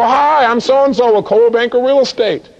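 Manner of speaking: eager real-estate agent introduction; upbeat salesperson. Acted. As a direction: greet the homeowner with a big sales pitch. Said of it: "how does the average salesperson take this?".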